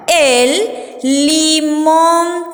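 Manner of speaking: very slow, stretched syllables; sounding out syllables. Shown as a demonstration. Teacher shows children how to spell, syllables drawn out slowly. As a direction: draw out each syllable of "limón".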